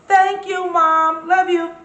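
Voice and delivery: high, loud thank-you to mom; loving shout-out. Real, not acted. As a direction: call out to mom with love.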